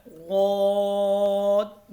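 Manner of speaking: गौद as Arabic daad, tongue side on upper molars, long o; thick, lengthened. Shown as a demonstration. Teacher demonstrates the hardest letter, गौद stretched full. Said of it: "पाँच अलिफ के बराबर लंबा करके".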